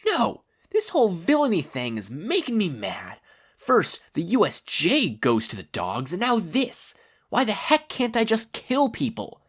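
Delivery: high whiny villain complaint; whiny villain rant. Acted. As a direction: throw a petulant villain's tantrum. Tomura ranting in story.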